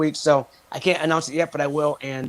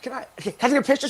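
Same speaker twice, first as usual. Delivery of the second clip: nervous high fan plea; 7 semitones higher, faster than usual. Retold or imitated. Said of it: "I'm like".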